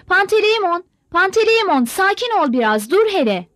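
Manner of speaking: high, loud calls of his name; the old mother calls to her husband to calm him; calling out anxiously. Acted. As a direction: call out to stop him, pleading. Mother calls out to stop fight.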